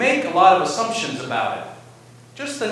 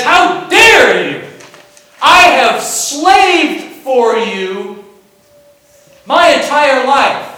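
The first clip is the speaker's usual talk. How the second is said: furious accusation; 6 semitones higher, 8 dB louder than usual. Retold or imitated. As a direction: hurl the grievance at the father. Son confronts his father angrily.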